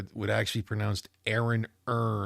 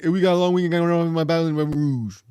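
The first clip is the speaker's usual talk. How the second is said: put-on Louisiana accent; 6 semitones higher, 8 dB louder than usual. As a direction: mumble it like a thick Baton Rouge local. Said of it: "it sounded like".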